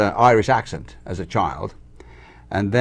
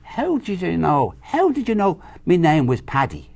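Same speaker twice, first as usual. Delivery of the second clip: lightly voiced Irish dad, higher; dad's retort; 6 semitones higher than usual. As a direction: imitate dad questioning the stranger. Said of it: "And he says".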